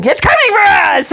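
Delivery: high, alarmed cry, retold; hammed-up high-pitched alarm; mock panic. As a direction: imitate a sudden panicked warning.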